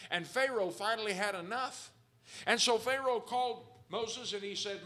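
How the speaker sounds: loud, fervent preacher voice; preaching at full force. Acted. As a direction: preach it out to the congregation. Preaching about the plagues.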